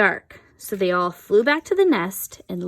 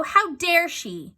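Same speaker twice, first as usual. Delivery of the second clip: mock outrage; 8 semitones higher, slower than usual. A narrator's reading. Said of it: "cried Missus Gregg".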